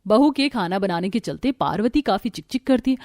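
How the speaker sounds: ordinary delivery, nothing marked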